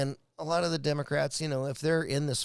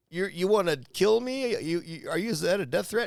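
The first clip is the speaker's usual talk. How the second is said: higher-pitched imitation of indignant disbelief; mock alarmed; 6 semitones higher, 3 dB louder than usual. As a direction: act out an affronted reply, voice raised. Acts out offended pundit's reaction.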